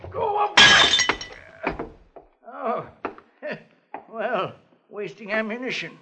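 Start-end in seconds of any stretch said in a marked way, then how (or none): 0.1-1.9 very slow, high, stretched call; long drawn-out call. Acted. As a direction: drag the words out as a call. Old man shooing a visitor.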